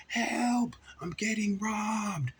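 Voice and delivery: high, loud, drawn-out cry; yelling for help. Retold or imitated. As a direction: holler so the family nearby hears. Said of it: "began to yell for help".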